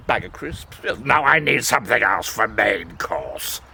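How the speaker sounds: deep, slow pondering wolf; a greedy wolf weighing his courses, low. Acted. Wolf musing on his meal.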